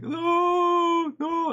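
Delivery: very high, loud panicked shriek; bystander screaming no. Retold or imitated. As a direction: scream it as if disaster already struck. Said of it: "this idiot starts screaming".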